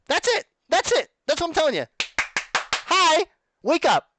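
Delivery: very high, loud outburst; high-pitched, fed-up holler; exasperated shouting. Real, not acted. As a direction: yell to rouse people who won't listen. Exasperated rant at the listeners.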